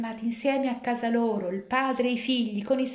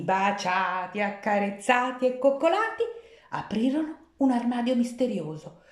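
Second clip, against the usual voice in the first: usual speaking voice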